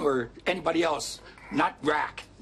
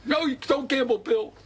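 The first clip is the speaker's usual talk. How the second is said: quoting his friend; 9 semitones higher, 3 dB louder than usual. Retold or imitated. Quotes his friend refusing him.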